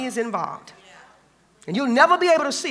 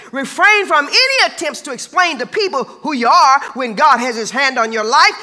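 louder, driven repeat of the warning; preached emphasis; 4 semitones higher, 5 dB louder than usual. Acted. Preacher repeating his warning to the congregation, louder. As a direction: hammer the line home to the church.

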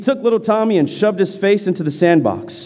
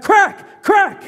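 high, punchy sound-effect crack; sharp crack cry; 12 semitones higher than usual. A narrator's reading. Narrator voices belt striking hands, high sharp crack. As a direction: snap the word out like a whip.

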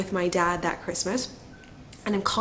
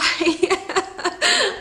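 high teary laugh; a tearful burst of laughter, high; 11 semitones higher, 3 dB louder than usual. Real, not acted. Vlogger choking up on camera, teary laugh. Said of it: "Trying not to cry".

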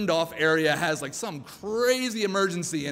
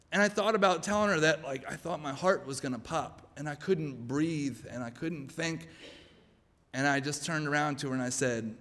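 ordinary talk

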